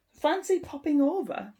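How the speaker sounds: bright model phrase. Shown as a demonstration. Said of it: "Would you like to come over".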